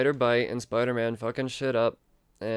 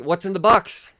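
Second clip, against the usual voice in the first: a hint of a louder movie yell; mock Brad Pitt; 7 semitones higher, 7 dB louder than usual. Reviewer quoting the movie meme, mildly yelling. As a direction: nod to the film line. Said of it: "Cue the Brad Pitt memes".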